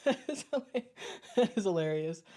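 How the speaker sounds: talking through leftover giggles; laughing. Real, not acted. Streamer tickled by a viewer's comment, laughing. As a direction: try to speak while still cracking up.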